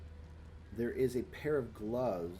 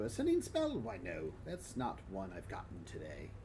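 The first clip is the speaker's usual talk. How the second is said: Crookstaff; 3 dB quieter than usual. Acted. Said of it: "he tells you".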